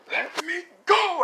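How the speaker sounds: high, drawn-out strained cry; mock furious yell. Deku to Kirishima while punching him, mock furious yell.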